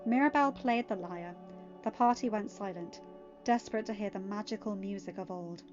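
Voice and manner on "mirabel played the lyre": usual speaking voice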